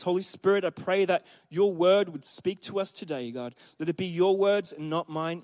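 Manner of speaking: soft, hushed prayer to God; quiet prayer. Acted. Pastor praying softly for the church. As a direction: pray gently over the congregation. Pastor prays before the sermon.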